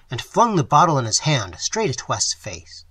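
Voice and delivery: usual speaking voice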